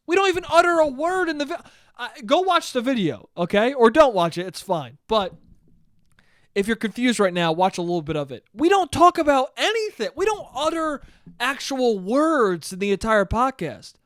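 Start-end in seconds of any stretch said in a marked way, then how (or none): 0.1-4.1 high, fast, outraged objection; indignant protest. Real, not acted. Podcaster disputing his uncle's account of the video, indignant. 8.5-13.9 indignant protest. Real, not acted. Podcaster denying they talk on the podcast, indignant. Protesting the uncle's false claim.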